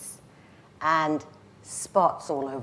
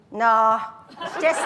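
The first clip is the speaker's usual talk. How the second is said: loud Glaswegian brush-off; pitched-up cheeky Glaswegian girl; gallus Glaswegian accent; 4 semitones higher, 4 dB louder, slower than usual. Acted. Gallus girl answers guy in dance hall, Glaswegian accent. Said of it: "I go into the accent".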